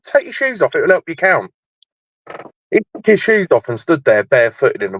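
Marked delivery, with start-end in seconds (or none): 0.0-1.5 mock helpful advice. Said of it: "I went".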